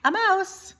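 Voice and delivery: high, firm correction; correcting princess. Acted. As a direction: set him straight, high and sure. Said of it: "corrected the Pea".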